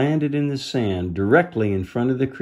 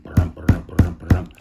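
beast rumble; 5 semitones lower than usual. Acted. Beast thunders toward the children.

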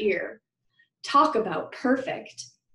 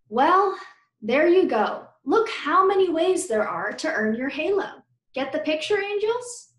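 high, upbeat teacher voice; rally the class warmly; cheerful teacher; 7 semitones higher, 3 dB louder than usual. A narrator's reading.

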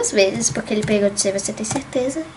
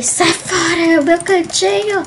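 high, loud mock shoo-away; playful shout; 7 semitones higher, 7 dB louder than usual. Girl shooing a boy from her game corner, playful loud shout. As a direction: chase him off your spot, half joking.

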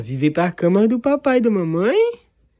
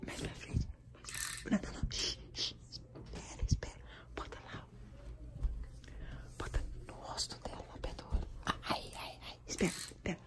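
urgent hushed whisper; 15 dB quieter, faster than usual. Real, not acted. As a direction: whisper instructions so she sleeps on. Whispering by sleeping mother.

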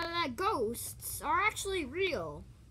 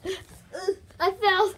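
whimpering cry; 4 semitones higher, 8 dB louder, slower than usual. Acted. Fell, crying out.

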